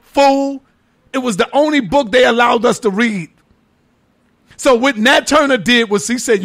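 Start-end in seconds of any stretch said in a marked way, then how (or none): none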